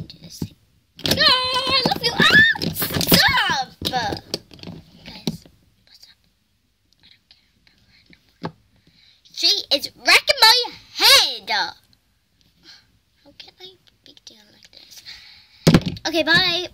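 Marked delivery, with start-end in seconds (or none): 1.1-3.7 squealing shout. Pretend. Toy squeals during play. 11.5-15.0 whisper. Real, not acted. Whispered complaint to viewers.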